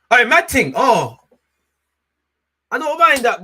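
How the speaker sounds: loud, high thrilled yelp; excited outburst. Real, not acted. Fan reacting loudly to a good chance. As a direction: burst out at a near miss.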